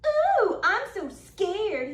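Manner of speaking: high mock fright, then slow; squeal, then drag out fake fear. Otter mocking the gator with fake fright. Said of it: "he mocks".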